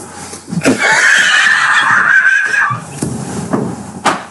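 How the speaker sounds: long high burst of laughter; real high-pitched laughing fit. Real, not acted. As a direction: crack up helplessly. Cracks up at pillow joke.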